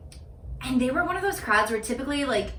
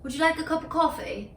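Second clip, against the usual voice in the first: high-pitched mock British accent; British accent attempt; 7 semitones higher than usual. Shown as a demonstration. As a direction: put on a polite British accent. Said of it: "What do, what do British people say?".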